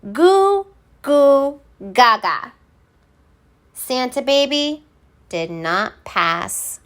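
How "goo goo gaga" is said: loud high ho ho ho, goo goo gaga; elves teaching. Acted. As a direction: coax a baby to repeat. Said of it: "Repeat after me".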